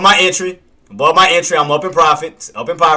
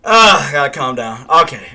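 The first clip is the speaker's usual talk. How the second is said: ordinary delivery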